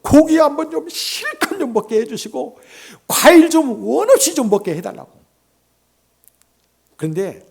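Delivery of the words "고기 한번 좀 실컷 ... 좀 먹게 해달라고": high, loud, fervent retold plea; retold pleading prayer. Pastor begging the Lord for meat and fruit, retold pleading. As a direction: retell her prayer with emphatic longing. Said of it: "주님께 기도했대요".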